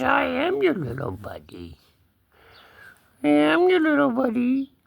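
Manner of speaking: high put-on kitty talk; cat voice. Cat answering his owner fondly, high cat voice. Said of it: "He says".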